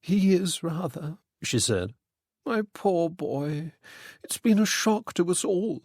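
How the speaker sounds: a hint of a sorrowing mother; grieving mother. A narrator's reading.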